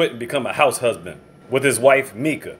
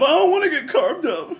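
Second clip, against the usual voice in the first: scared salesman; 13 semitones higher than usual. Retold or imitated. Scared salesman's inner thought.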